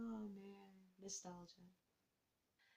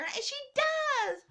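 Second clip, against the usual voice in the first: excited exclaim; 4 dB louder than usual. Real, not acted. Excited retelling of death.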